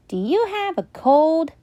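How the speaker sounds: put-on high, concerned child question; a small boy worried for his friend; worried little boy. Flynn asking.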